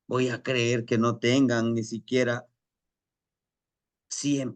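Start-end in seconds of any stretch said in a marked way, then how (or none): none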